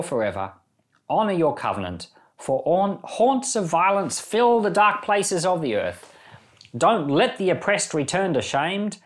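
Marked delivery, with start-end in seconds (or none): none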